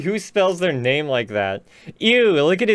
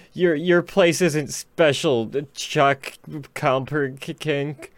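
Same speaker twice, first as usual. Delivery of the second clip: mock solemn; slower than usual. Mock solemn address to author.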